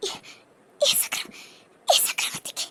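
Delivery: barely voiced, broken fragments of shock; words fail, breath catching between syllables; stunned disbelief. Shocked by news of accident. (அதிர்ச்சியில் குரல் வராமல் திணறல்)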